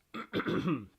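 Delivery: loud throat clear after gulping tea. Real, not acted. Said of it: "I'm drinking way too fast".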